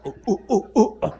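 loud, rising ape hoots; monkey hoots. Acted. Monkeys in the aviary calling, loud hoots. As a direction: hoot like a chattering monkey. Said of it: "así hacían".

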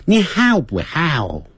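slow "how" opened on ha then closing; drawn-out how. Shown as a demonstration. Teacher repeating how slowly for students. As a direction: drag out "how" to show the glide. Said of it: "先念哈的音".

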